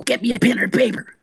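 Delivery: excited urgent. Retold or imitated. Urgent request to girl.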